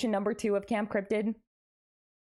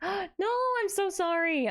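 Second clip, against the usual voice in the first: gasping sorry; 9 semitones higher than usual. Real, not acted. Gasps at friend being sick.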